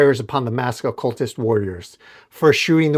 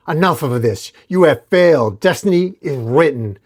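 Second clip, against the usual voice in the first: louder, peaking higher on "failed"; villain yell; 3 semitones higher, 6 dB louder than usual. Acted. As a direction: bellow at the fighters as the villain. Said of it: "As Slay enters and yells".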